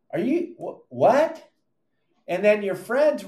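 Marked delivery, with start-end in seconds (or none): 0.1-1.5 baffled. Acted. Baffled reply to friend's nagging.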